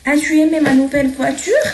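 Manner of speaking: high rising tune, "voiture" lifted at the end; teacher showing a question tune; question intonation. Shown as a demonstration. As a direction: ask it, pitch going up on "voiture". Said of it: "غيرت نبرة صوتي".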